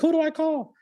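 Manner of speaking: squeaky high anxious voice; panicky question. Retold or imitated. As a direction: ask it like a nervous friend. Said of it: "She was like".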